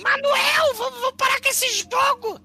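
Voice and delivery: screeching mock old mother scolding; Monty Python old lady. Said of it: "imitando a velha".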